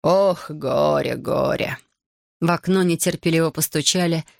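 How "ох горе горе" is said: slow, doomed lament; resigned sigh. A narrator's reading. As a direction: sigh the words out slowly, without hope. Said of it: "обречённо вздохнул". (обречённо, со вздохом)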